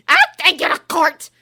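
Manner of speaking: high, very loud furious threat; furious shout. A narrator's reading. Umbridge furiously shouting at Bill and Ron. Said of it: "shouted Umbridge furiously".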